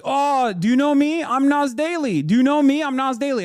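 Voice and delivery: high-pitched mocking self-introduction yell. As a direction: imitate a man loudly demanding to be recognized. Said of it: "yelling with a phone camera in tow".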